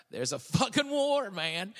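sudden high, loud outrage; outraged outburst. Real, not acted. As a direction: blurt it out, furious and loud. Outraged rant about wars in Africa.

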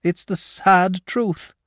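high, chatty dragon voice; a lazy, cheerful dragon confiding; genial dragon. Acted.

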